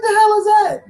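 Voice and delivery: high, loud "the hell is that?"; shocked outburst. Retold or imitated. Retelling his shock at the chilled dish, high and loud. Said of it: "And I said".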